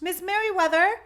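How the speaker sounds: high, loud tattling call; loud, high tattling call to the librarian. Acted. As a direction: shout the news as you hurry. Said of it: "he called as he walked".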